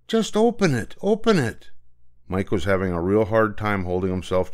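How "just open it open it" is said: impatient. Acted. Mike impatient, can't hold himself.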